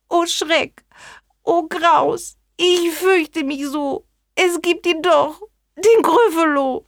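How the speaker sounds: high, frightened cry of fear; mock fright. Gryffalo spooked by tales of himself, high and fearful. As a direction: squeal it out as if terrified.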